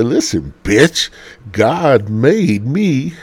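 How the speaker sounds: hammed-up pulpit preaching; mock preacher. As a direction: preach it to the congregation like a rowdy pastor. Mock pastor preaching to crowd.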